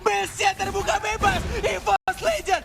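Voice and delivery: high, loud play-by-play shouts; hype caster. Real, not acted. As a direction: build to the victory call. Caster calls the winning push.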